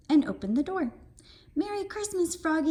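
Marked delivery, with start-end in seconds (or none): 1.5-2.7 yelled greeting. A narrator's reading. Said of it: "yelled Max".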